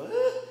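high, loud puzzled squeak; short mock noise of confusion; confused "eh". Preacher voicing the congregation's puzzlement, confused squeak. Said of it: "a little confused because we go".

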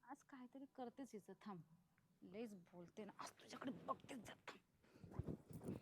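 very soft, low plotting; hushed, vengeful soliloquy; scheming to oneself. Acted. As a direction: think aloud under your breath, menacingly. Plots alone against daughter-in-law.

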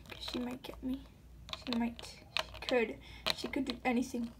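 hushed, playful whisper; mock fearful whisper. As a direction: whisper as if the kitty might hear. Whispering about dangerous superhero kitty.

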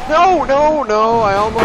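loud cries, starting high and dropping; dismayed. Real, not acted. Player who almost made it, loud dismayed protest. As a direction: protest in panic, then break off. Player dying just short.

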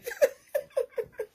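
high burst of laughter. Real, not acted. Still laughing at her own joke, high. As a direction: laugh trailing off.

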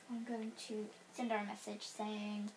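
normal talking voice

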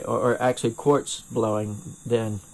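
normal talking voice

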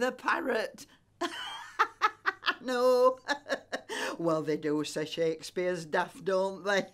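laughing. Real, not acted. Laughing on the phone to friend.

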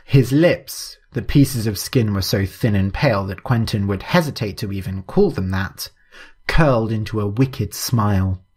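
ordinary delivery, nothing marked

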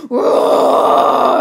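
long, loud bear roar; noisy roar of a bear losing his cool; angry roar. A narrator's reading. Bruce roaring at unwanted guests, long and loud. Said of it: "Bruce started to lose his cool".

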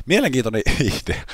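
amused at the game idea; "Mielenkiintoinen" said with a laugh breaking in. Real, not acted.

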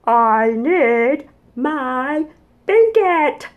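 put-on high, pleading child voice; small dinosaur wanting his blanket; whiny little dinosaur. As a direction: whine like a tired little kid.

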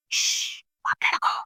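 soft shushing hiss; quiet the child, barely voiced; mother hushing her daughter softly. A narrator's reading. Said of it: "అంటుంది అమ్మ".

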